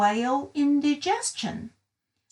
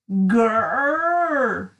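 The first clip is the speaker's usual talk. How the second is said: loud whale growl; 4 dB louder than usual. Acted. Whale rumbling in story.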